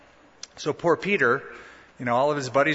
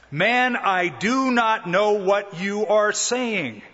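slow, drawn-out denial pitched up; emphatic denial; 5 semitones higher, much slower than usual. Acted. Peter denies Jesus a third time, emphatic denial. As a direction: deny it word by word, insistently.

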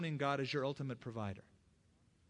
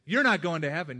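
louder, self-righteous condemnation; angry rebuke; 3 semitones higher, 11 dB louder than usual. Retold or imitated. Son condemning his father, loud and self-righteous. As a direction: bark the verdict at him. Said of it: "now I could get angry".

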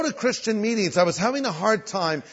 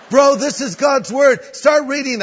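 pushy bro; 7 semitones higher, 6 dB louder than usual. Retold or imitated. Stranger presses Bible on Greg.

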